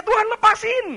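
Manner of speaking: high, loud acted cry for release; pleading yell. Believer begging God to set him free, pleading yell. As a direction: yell it upward as a desperate plea. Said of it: "kita mau teriak-teriak".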